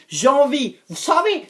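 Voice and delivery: ordinary talk, nothing marked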